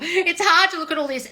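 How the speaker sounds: mocking whiny victim. To a self-pitying fan, a mocking whiny victim voice. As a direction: mimic a self-pitying crybaby. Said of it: "being a boo-hoo".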